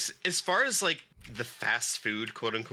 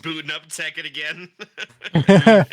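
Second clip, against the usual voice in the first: laughing; 6 semitones higher, 16 dB louder, slower than usual. Real, not acted. Laughing joke mid-conversation.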